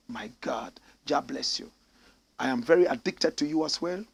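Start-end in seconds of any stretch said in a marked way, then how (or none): 0.1-1.7 soft breathy awe; barely voiced, touched; whispered gratitude. Real, not acted. Host moved by a viewer's praise, whispered gratitude.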